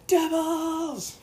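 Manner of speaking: high, hammed-up scream of Devils!; quoted scream. Quoting a man screaming at a nun, high screech. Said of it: "The guy screams".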